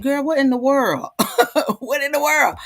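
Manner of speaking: put-on high teasing girl, what in the world; friend's astonished voice. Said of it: "and she said".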